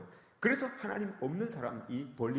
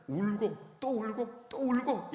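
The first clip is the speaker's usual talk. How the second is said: higher, slow retelling of weeping; 7 semitones higher, much slower than usual. Retold or imitated. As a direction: stretch each repeat to depict endless crying. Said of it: "통곡을 하면서 운다는". (통곡을 길게 늘여 묘사하듯)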